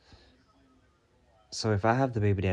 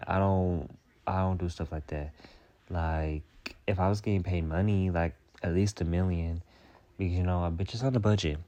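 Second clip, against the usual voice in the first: normal talking voice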